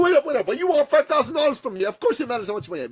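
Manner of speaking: high, fast, incredulous outburst; flustered retold objection; indignant protest.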